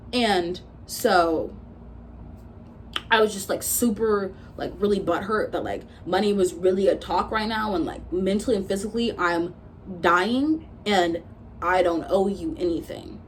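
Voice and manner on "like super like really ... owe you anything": ordinary talk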